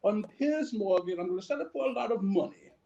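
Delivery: hammed-up English accent, badly done; clumsy posh British impression. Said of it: "That was the worst accent ever".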